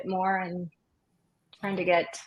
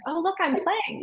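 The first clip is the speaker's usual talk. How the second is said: put-on high, excited little-kid voice; 9 semitones higher than usual. Little kid showing teacher ukulele strumming, excited. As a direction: pipe up like a proud child.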